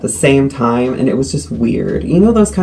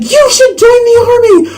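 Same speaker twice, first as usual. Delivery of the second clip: much higher, much louder, fast, almost screaming; near-screaming recruiter; 5 dB louder, faster than usual. Retold or imitated. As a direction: bellow it at her like an overeager army recruiter. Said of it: "one notch down from screaming".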